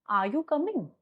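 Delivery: low pitch demo. Shown as a demonstration. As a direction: ask it without pushing the voice. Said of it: "this is my low pitch sound".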